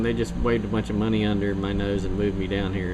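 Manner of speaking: ordinary delivery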